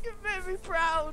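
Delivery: very high, squeezed praise; real giggly, squeaky voice; proud through laughter. Real, not acted. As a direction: congratulate while still cracking up. Still in hysterics, praising impression.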